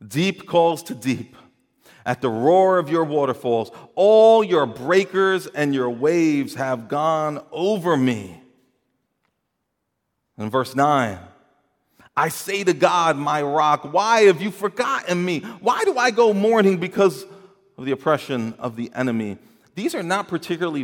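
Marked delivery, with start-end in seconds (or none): 0.1-8.4 loud, drawn-out psalm reading; dramatic scripture reading. Acted. Reads the psalm aloud. 12.2-19.4 raised, loud voice of a pleading psalmist; impassioned scripture reading. Acted. Reads the psalm aloud.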